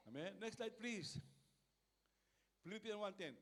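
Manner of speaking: normal talking voice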